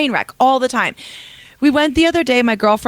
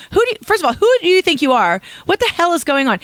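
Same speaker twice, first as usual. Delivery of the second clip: higher-pitched indignant demand, short of a yell; retold angry yelling; 7 semitones higher, faster than usual. Driver imagining telling off the woman through the window, indignant. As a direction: act out confronting someone through a car window. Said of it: "so angry and yelling and screaming".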